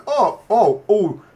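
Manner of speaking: high, loud put-on concern; exaggerated gullible oh's; mock sympathy. Pretending to fall for a beggar's story, mock sympathy.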